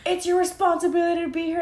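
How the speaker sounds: high, quick, stern mock boss; scolding boss. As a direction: lecture her like a strict manager.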